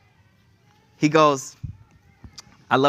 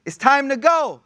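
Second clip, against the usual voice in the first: raised, carrying call, higher pitch; mock call from the wall; 6 semitones higher than usual. Righteous man on the city wall calling out to everyone, a raised mock yell. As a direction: call it out over the city like a night crier. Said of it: "he would yell out".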